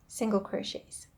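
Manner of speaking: ordinary talk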